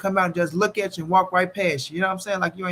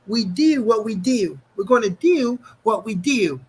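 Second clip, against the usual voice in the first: slow, higher mock coach voice; imitating Coach Cal; 4 semitones higher, 3 dB louder, slower than usual. Co-host quoting Coach Cal, slow and hammed. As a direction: drag out the coach's slogan, lightly hammed. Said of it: "Coach Cal Ford was like".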